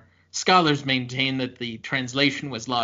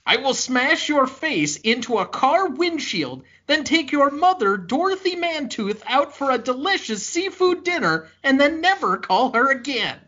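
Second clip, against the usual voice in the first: high-pitched, hammed-up angry rant; acted outrage, pitched way up; mock threat; 9 semitones higher than usual. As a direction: threaten a rival in a raised, theatrical voice.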